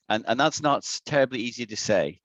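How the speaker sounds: usual speaking voice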